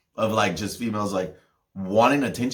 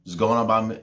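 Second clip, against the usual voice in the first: normal talking voice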